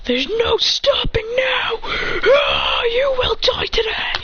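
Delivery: slow high whisper building to a crying shout. Acted. Fighter powering up on Hit, whisper building to shout. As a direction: start hushed then explode. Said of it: "He goes".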